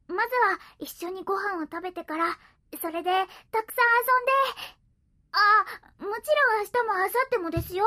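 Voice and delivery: high, loud and fast; excited. Acted. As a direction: rattle off the plans eagerly. Cat eagerly plans their weekend.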